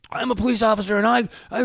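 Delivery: high, loud, blustering mock yell; mock screaming. Raging policeman screaming at a parent, mocked. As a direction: imitate a man screaming out his credentials. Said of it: "he's screaming at me that he's a policeman".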